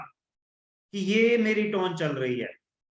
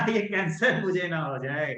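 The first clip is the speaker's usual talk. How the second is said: laughing it off; 3 semitones lower than usual. Shown as a demonstration. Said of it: "या हंसते हुए थोड़ा सा".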